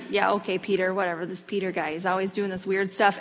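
mumbled, eye-rolling brush-off; dismissive disciples. Retold or imitated. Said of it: "other disciples kind of being like".

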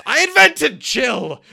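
high-pitched, very loud yell; drawn-out comic holler, way up high; imitated yell. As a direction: holler it out slow and full-voiced. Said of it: "when he yells".

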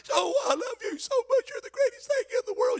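high, gushing falsetto with a laugh; mock ex-girlfriend. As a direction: ham up the sweet-talking ex. Mocking ex-girlfriend begging back.

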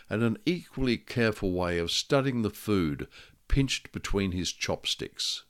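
ordinary delivery

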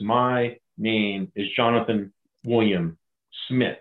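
every syllable of "My name is Jonathan William Smith" stressed, slow and deliberate; stressing each syllable. Retold or imitated. Senior man answers the challenge, slow and deliberate. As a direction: lay down each syllable, slowly. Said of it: "stressing each syllable".

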